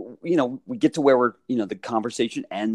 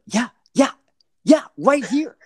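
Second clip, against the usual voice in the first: excited confirming; 11 semitones higher, 5 dB louder, slower than usual. Retold or imitated. Player confirming to wife on phone.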